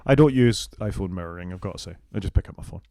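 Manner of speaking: normal talking voice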